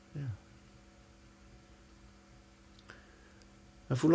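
calm, unhurried meditation voice; guided meditation. Acted. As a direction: gently lead them into relaxation. Guides a body relaxation exercise.

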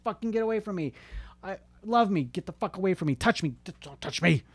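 hammed-up rejection, starting high-pitched; mock push-pull outburst. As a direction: act out begging and shoving away at once. Acts out pushing loved ones away.

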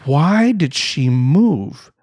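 very slow, drawn-out indignant question; retold upset. Husband spotting his moved family on the beach, retold upset. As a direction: stretch every word in mock outrage. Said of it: "I get upset".

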